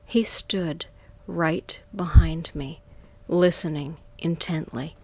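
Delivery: ordinary delivery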